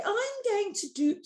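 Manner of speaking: very high put-on Karen voice; mock a Karen's accent, high-pitched; fake Karen accent. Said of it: "stop doing my fake Karen accent".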